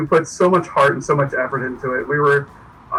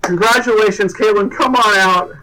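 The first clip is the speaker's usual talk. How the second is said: raised, celebratory host call; bright-spirited announcement, pitched up; excited welcome; 5 semitones higher, 3 dB louder than usual. Real, not acted. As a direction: call the winner out. Host calls winner out.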